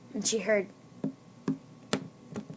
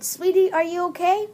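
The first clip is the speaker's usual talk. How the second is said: high, louder voice; sweet caller; 8 semitones higher, 6 dB louder than usual. Acted. Caller checking on the girl, high acted voice. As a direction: ask it in a high voice.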